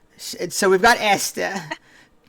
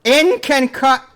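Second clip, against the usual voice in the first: mocking imitation; 5 semitones higher, 4 dB louder than usual. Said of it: "that's what you sound like".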